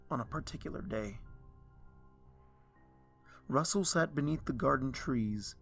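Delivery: ordinary delivery, nothing marked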